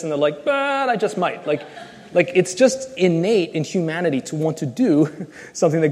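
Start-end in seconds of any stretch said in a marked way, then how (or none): 0.4-1.5 put-on high, laughing cheeky kid voice; defiant kid. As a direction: tease it like a child testing the rule. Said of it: "You tell a kid".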